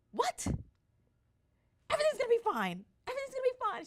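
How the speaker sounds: very high, loud mom impression; practical mom's voice. Mom answering screaming daughter, loud high-pitched insistence. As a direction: shout the reassurance insistently. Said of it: "my mom says".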